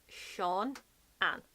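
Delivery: Sean, pause, Ann: SHAWN ... un; broken-down pronunciation. Shown as a demonstration. Teaching the name in parts, Sean then Ann. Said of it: "so it's Sean".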